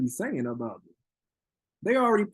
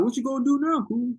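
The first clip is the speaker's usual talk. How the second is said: mocking quote; 9 semitones higher than usual. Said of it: "Everybody was like".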